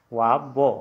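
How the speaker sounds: वाबो said clearly, the w rounded; Assamese letter name. Shown as a demonstration. Teacher repeats the Assamese name for व. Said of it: "व को हम असमिया में वाबो बोलेंगे".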